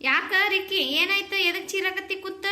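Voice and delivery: big sister yelling to her little brother; calling out. Acted. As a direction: shout to the boy from another room. Sister calls out to shouting boy.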